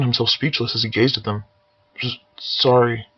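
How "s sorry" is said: choked out. Acted. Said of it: "he finally choked out".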